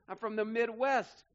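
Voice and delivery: normal talking voice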